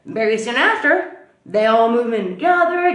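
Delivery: ordinary talk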